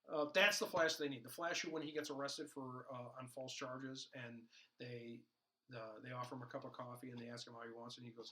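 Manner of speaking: ordinary talk, nothing marked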